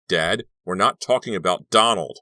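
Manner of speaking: loud angry outburst at his father; scream. A narrator's reading. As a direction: shout it, fed up. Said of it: "Kerry was the one to scream first".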